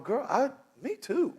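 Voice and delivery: gushing over-agreement; eager agreement. Retold or imitated. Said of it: "you're going".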